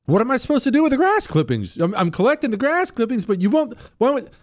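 high, fast mock exasperation; freaking out. Homeowner protesting over uncollected grass clippings, high exasperated freak-out. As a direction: act out a high-pitched freak-out. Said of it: "Everyone started to freak out".

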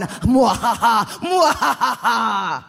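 slow, loud villain cackle; evil laugh. Acted. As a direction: cackle like a gloating evil lord. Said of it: "said the Dark Lord".

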